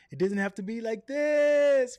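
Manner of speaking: loud, high, hammed-up announcer delivery; exaggerated big inflection; over the top. Shown as a demonstration. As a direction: go overboard, stretching THIS high and loud.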